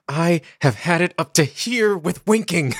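hammed-up exasperated aside. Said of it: "under his breath, he says".

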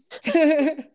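high laughing burst; burst of laughter. Real, not acted. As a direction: laugh out loud. Laughs at own fantasy.

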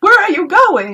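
high, loud fussy outburst; C three P O. Retold or imitated. C three P O calls after departing R two D two, high loud impression. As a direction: cry out in a high-pitched, pestering voice. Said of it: "C three P O is like".

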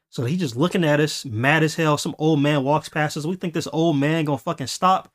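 ordinary talk, nothing marked

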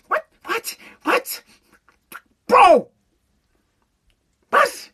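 hammed-up disbelief, squeaking "what?"; incredulous squeak. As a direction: play it like you can't believe your ears. Mock disbelief at permissive parents.

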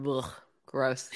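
low, disgusted groan of "gross"; annoyed ugh. Real, not acted. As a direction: grumble it under your breath with distaste. Groaning at a disliked pick.

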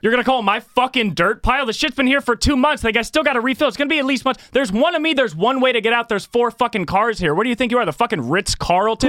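high-pitched, indignant tirade; worked-up, high-pitched outburst; angry role-play rant. Acted. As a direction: fire back as the fed-up lot attendant. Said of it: "Let's do a role play".

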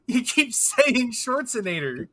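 high, drawn-out teasing call-out. Real, not acted. Friend catching a mangled star's name, teasing and drawn-out. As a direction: stretch it out slowly and high, laughing at the slip.

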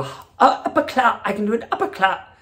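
laughing mock Cockney "upper clap"; hammy Cockney accent, laughing. Shown as a demonstration. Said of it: "I can do a Cockney accent".

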